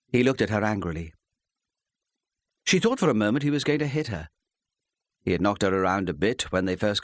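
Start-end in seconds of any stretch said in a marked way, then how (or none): none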